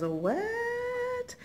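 very high, drawn-out mock disbelief; playful amazement. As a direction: squeal it as if stunned by the find. Mock amazement at new bags.

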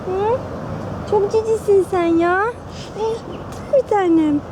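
high, sweet pet talk; cooing to a cat. Real, not acted. Woman cooing affectionately at a stray cat, high and sweet.

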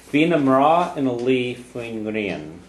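Vina Mra in a Lee Fuingrian read slowly and clearly; teacher's Irish model. Shown as a demonstration. Teacher reads the third Irish line slowly to model it. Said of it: "number three".

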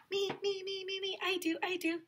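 high, soft squeal of a volunteer; eager pupil. Pretend. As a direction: pipe up softly like an eager child. Imitating eager children answering.

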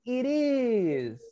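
slow, stretched "Here it is"; slow practice model. Shown as a demonstration. Teacher urges practice of the seller's line, slow model. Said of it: "Practice, practice".

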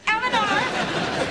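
drawn-out scolding of her name, high; exasperated mother drawing out the name; stern scolding call. Acted. Scolding daughter by full name.

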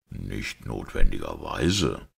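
slow, low, mild reply; faint smile. A narrator's reading. Priest gently disagreeing with the doctor, faintly smiling. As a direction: answer slowly with a weary smile. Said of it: "mit mattem Lächeln".

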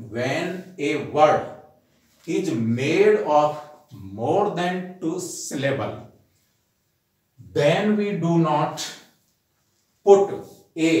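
very slow, word by word, pausing between phrases; dictating a rule. Shown as a demonstration. Teacher dictating an English rule to students, slow and deliberate. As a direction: spell out the rule slowly so students can write it down.

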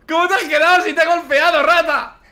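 queja aguda y risueña; protesta entre risas. Real, not acted. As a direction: protesta indignado aguantando la risa. Protesta riendo por la trampa. (indignación aguda entre carcajadas)

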